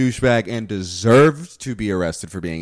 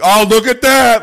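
mock-smug cop, much higher and louder, slow; 14 semitones higher, 12 dB louder than usual. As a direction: ham up a cop gloating over a find. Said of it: "He's like".

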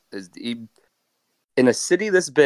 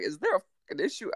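high, softer mock New York jive accent; 7 semitones higher, 7 dB quieter than usual. Train conductor asking about trouble, high New York jive accent. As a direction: put on a sassy New York jive, pitched up. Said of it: "trying to do an accent".

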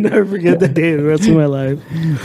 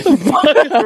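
disgusted; 8 semitones higher than usual. Retold or imitated. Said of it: "kinda disgusted".

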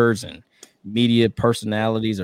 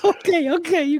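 laughing okay. Real, not acted. Laughing acceptance.

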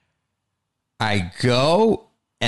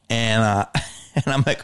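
usual speaking voice

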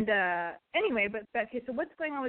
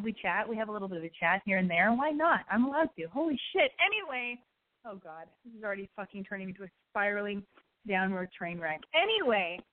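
normal talking voice

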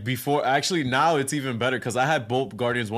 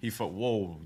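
ordinary talk

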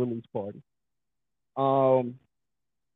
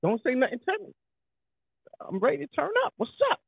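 hyped up; 10 semitones higher, 5 dB quieter, faster than usual. Pretend. Acting hyped New York mood.

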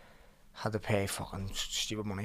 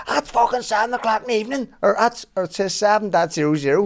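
high, loud mock fury; retold shouting over the flight time; retold angry yell; 10 semitones higher, 8 dB louder than usual. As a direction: yell the time back in disbelief.

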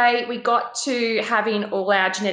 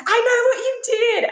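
mock menacing. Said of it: "I can just be like".

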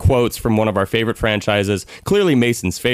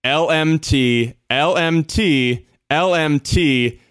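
slow spelled-out letters; 4 semitones higher, much slower than usual. Shown as a demonstration. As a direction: spell each letter out slowly. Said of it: "Bear with me here".

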